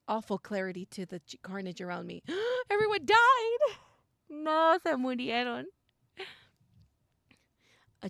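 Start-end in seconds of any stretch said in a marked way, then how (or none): 2.3-5.6 high, loud horrified outburst; shocked gasp and cry. Real, not acted. Player reacting to the carnage in the game, horrified.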